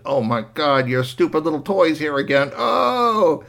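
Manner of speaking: whiny complaint rising to a high wail; pained annoyance. Acted. Injured boy seeing the toy return, exasperated.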